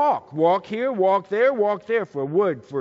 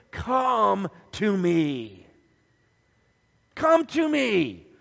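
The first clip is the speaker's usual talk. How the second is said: slow, rising preacher's call of the Lord; Jesus bidding powerfully; 2 semitones higher, much slower than usual. Acted. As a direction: bid the weary to come, slow and strong. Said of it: "He's bidding you powerfully".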